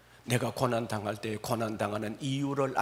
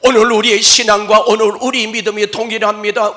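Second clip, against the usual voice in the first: loud, fervent exhortation; full-force preaching; 9 semitones higher, 16 dB louder than usual. Real, not acted. Preacher exhorting the church, loud. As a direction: press it on the congregation.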